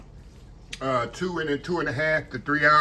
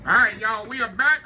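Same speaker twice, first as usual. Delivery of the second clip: excited greeting; 7 semitones higher, 3 dB louder than usual. Real, not acted. Greeting viewers on returning.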